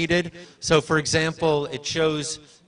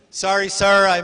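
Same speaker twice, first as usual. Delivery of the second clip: firm call; 5 semitones higher, 7 dB louder than usual. Real, not acted. Asking man to sit.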